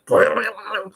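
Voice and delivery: burbling mouth-made stomach rumble; imitated stomach gurgle. Showing viewers the sound the stomach makes, imitated gurgle. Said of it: "it'll make this sound".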